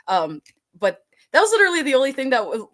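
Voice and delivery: usual speaking voice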